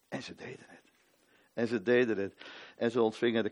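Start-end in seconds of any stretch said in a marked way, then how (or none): 0.1-0.9 whispered, almost voiceless. Acted. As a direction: breathe it out in awe. Repeated line, first whispered.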